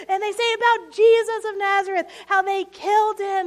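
normal talking voice

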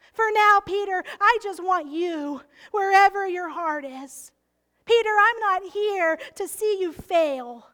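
gentle but full-voiced plea as Jesus; Jesus speaking to Peter. Jesus pleading with Peter, loud and earnest. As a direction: speak as the Lord, calling Peter home.

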